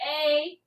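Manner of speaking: "a" said as the letter name, rhyming with day; long A. Shown as a demonstration. Teacher naming the word for pupils, "a" with a long A. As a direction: say "a" as a full long vowel. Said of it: "Instead of saying".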